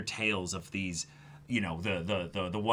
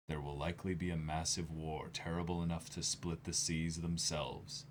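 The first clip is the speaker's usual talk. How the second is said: lower, softer solemn reading voice; dramatic manga reading; 5 semitones lower, 9 dB quieter than usual. Acted. Fan reading Oden's last words to Toki, softer and lower. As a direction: read it like a grave farewell. Reads manga dialogue aloud.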